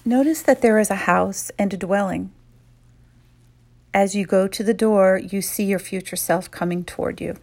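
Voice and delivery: calm, unhurried meditation voice; guided meditation. Acted. Guide leading women leaders through a visualization, calm and soothing. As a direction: lead them gently inward, pausing often.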